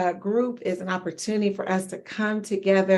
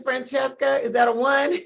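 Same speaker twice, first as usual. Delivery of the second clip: ordinary talk, nothing marked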